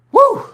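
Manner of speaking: high, loud whoop; real fired-up woo. Real, not acted. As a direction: let out a big whoop. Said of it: "I got going there".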